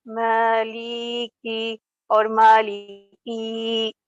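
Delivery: Maliki with the li and ki dragged out; wrongly prolonged syllables. Retold or imitated. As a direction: stretch every vowel of Maliki. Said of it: "you don't have to prolong".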